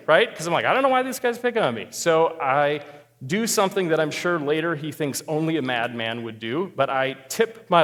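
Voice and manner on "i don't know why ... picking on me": higher, fast indignant inner voice; mock angry. Diner annoyed at the staring stranger, fast and indignant. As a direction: blurt it out, offended and fast. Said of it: "I'm getting kinda angry".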